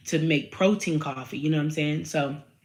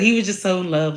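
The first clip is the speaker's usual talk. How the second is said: ordinary talk, nothing marked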